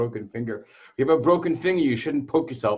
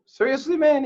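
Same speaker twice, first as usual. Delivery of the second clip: incredulous outburst; 7 dB louder than usual. Retold or imitated. Incredulous reply to collector.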